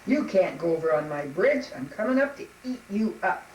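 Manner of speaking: loud troll bluster; booming storybook monster; threatening troll. Acted. Troll threatens middle goat.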